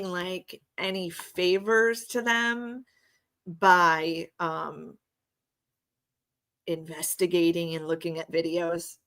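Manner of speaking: normal talking voice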